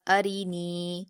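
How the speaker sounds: ارینی with quick ا and ر, only نی drawn long; short ا and thin ر, then stretch نی; careful recitation. Shown as a demonstration. Teacher modelling ارینی, last ی drawn long. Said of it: "اس کو جلدی پڑھیں گے".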